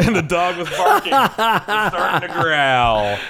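talking through laughter; laughing. Real, not acted. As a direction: laugh out the words.

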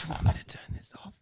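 quick unvoiced mock whisper; fast whisper. Friend mocks the creepy host by whispering fast. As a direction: rattle it off in a hushed whisper.

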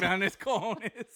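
put-on gringo-accented slow Spanish; American accent Spanish. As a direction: say it like a gringo, drawn out. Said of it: "But he said it like a gringo".

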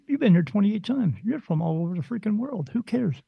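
normal talking voice

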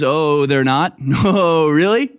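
sarcastic, rising disbelief; mock surprise. Sarcastic retort to DeSantis's denial.

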